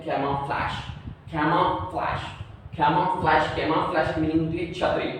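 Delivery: ordinary delivery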